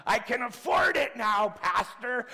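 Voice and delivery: high, loud happy shout; joyful shout. Retold or imitated. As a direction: shout it out, delighted. Lori shouts joyfully to pastor.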